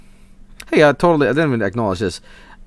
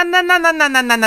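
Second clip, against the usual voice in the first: high rapid nah nah; high protest; faster than usual. Real, not acted. Streamer rejecting the price, high protest. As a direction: refuse in a rising whine. Refusing a costly build.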